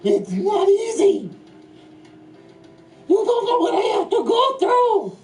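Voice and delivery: high, loud wailing complaint; mock-cry over old age; whiny old man. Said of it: "I'm old".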